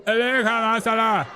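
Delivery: high, loud reply with a laugh; mock Somali accent. Echoing the campaign clip in a mock Somali accent. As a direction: chant it back in a put-on Somali accent. Chanting back with a played clip.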